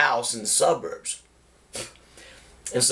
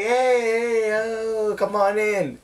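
put-on girls' greeting; 9 semitones higher, slower than usual. Girls greeting them at door.

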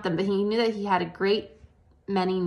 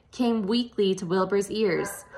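plain reading voice

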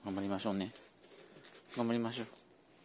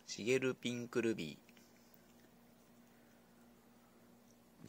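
シゲルピンクルビー stretched syllable by syllable; very slow drawn-out name; 2 semitones lower, much slower than usual. Shown as a demonstration. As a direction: draw シゲルピンクルビー out slowly like an announcer. (「シゲルピンクルビー」をゆっくり引き伸ばして)

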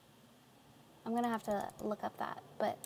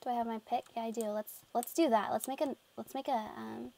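normal talking voice

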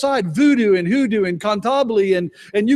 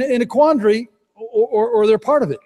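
ordinary delivery

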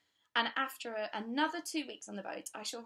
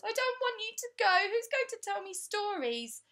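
put-on high, loud child's plea; pleading little girl; 12 semitones higher, 8 dB louder than usual. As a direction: beg like a small pupil losing her friend.

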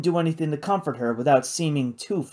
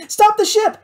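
loud, very high cry of alarm; sudden urgent cry; 7 dB louder than usual. A narrator's reading. Kate spots something and wants the ship stopped, sudden high cry. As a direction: call out urgently, pitched way up. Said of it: "Kate said suddenly".

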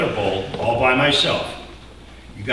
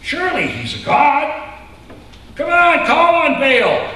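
much higher, louder mocking jeer; taunting; 14 semitones higher, 5 dB louder than usual. Elijah jeers at Baal's prophets in a loud taunt. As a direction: goad them on. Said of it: "Elijah began to taunt them".

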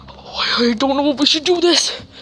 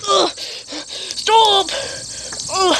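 crying out in fear; 7 semitones higher, much slower than usual. Acted. Survivor attacked, pleading.

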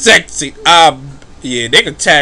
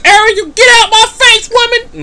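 high shrieking protest; 5 dB louder than usual. Retold or imitated. Sim yelling at the mermaid Ariel in high panic. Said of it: "Like, what is this?".

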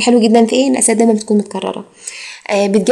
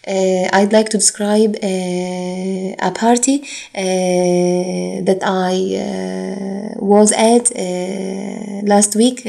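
hesitant filler-laden answer; much slower than usual. Shown as a demonstration. Teacher mimics a student stalling in the speaking exam, hesitant and halting. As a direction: stall between phrases on drawn-out uhs. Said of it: "ما ينفعش اجيب في السبيكنج اقعد اقول".

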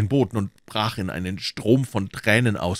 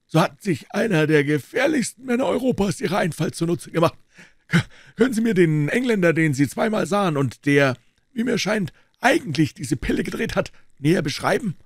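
a lightly voiced doctor, a little higher; a hint of a composed doctor; the doctor explaining why he came in, lightly voiced; 10 semitones higher, 4 dB louder than usual. A narrator's reading. Doctor speaking in the story.

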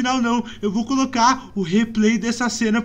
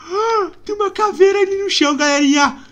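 high, whimpering cry; frightened cry; 8 semitones higher, 4 dB louder than usual. Real, not acted. Scared gamer showing viewers a skull.

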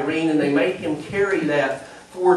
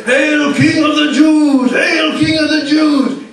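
put-on loud, high, slow shout in German accent; hammed-up German-accented hailing, drawn out; mock German accent; 11 semitones higher, 10 dB louder, slower than usual. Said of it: "in a good German accent".

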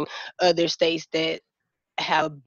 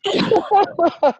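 higher and louder, fast, then laughter; emotional laugh; 11 semitones higher, 4 dB louder, faster than usual. Real, not acted. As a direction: laugh through remembered tears. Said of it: "I cried after".

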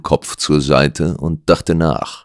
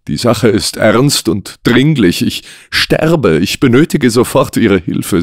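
higher, urgent pleading voice; desperate client; 4 semitones higher than usual. Acted. Cursed man begs the detective for help, desperate.

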